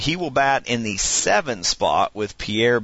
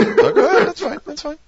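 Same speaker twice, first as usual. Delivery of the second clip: laughing; 6 dB louder than usual. Real, not acted. Laughs at friend's slip.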